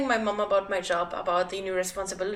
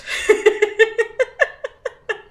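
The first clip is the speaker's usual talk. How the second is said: loud high laugh; 13 semitones higher, 10 dB louder than usual. Real, not acted. Laughs after story.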